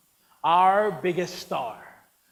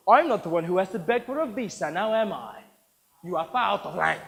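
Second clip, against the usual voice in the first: ordinary delivery, nothing marked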